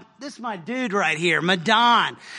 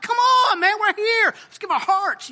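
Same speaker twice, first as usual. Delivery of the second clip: pumped-up urging; 10 semitones higher, 3 dB louder than usual. Retold or imitated. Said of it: "I'm like".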